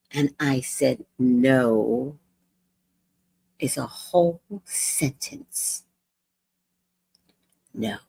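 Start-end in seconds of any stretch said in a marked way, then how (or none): 1.2-8.0 slow, deliberate put-down; calm, final no; firm refusal. Retold or imitated. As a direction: spell it out, unmoved. Lecturing the woman firmly.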